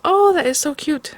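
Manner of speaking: high, delighted oh; cooing at a cute stamp. Real, not acted. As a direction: melt over how cute it is.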